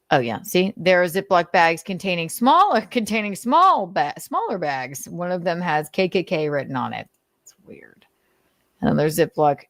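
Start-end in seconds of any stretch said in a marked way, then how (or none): none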